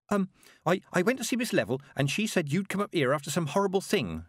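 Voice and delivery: put-on high, hesitant young girl; girl reporting to the witches; nervous girl. A narrator's reading. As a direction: stammer out the news shyly. Nervous Petulia voiced.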